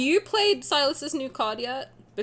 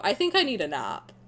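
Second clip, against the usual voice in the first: ordinary talk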